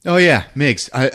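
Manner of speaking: ordinary talk, nothing marked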